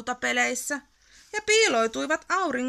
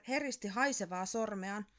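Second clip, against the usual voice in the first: ordinary delivery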